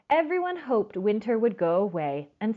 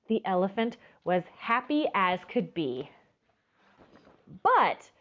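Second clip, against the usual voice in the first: ordinary delivery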